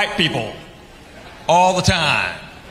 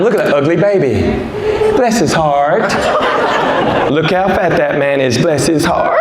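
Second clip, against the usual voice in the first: mock Southern lady gossip; 5 dB louder than usual. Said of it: "They do it all the time".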